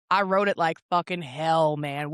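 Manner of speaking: low, understated deathbed boast; low, satisfied reminiscence. Acted. Imagined woman on her deathbed looking back, low understated pride. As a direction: say it low and contained.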